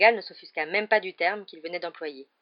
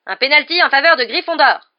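loud, higher call of the penalty; referee shout; 8 semitones higher, 15 dB louder than usual. Acted. Referee awarding the penalty to the team, shouting. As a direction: announce the penalty across the pitch.

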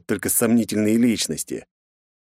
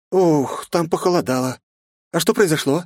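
higher, lighter female voice; young woman voice; 8 semitones higher than usual. Acted. Female companion Grace speaks.

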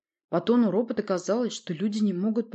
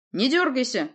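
higher, louder warning; threatening; 7 semitones higher, 6 dB louder than usual. A narrator's reading. Sorceress warns the shackled prince, threatening. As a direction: warn sharply against any move. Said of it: "пригрозила колдунья".

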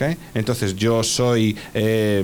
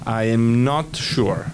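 slow model; slower than usual. Shown as a demonstration. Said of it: "teacher models slowly".

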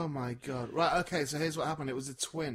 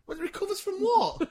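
incredulous; 12 semitones higher, 5 dB louder than usual. Real, not acted. Incredulous retort to online comment.

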